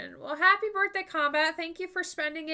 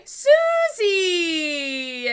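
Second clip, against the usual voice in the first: loud high drawn-out call; 7 semitones higher, 10 dB louder than usual. Real, not acted. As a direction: call her name across the room. Calling out to raider.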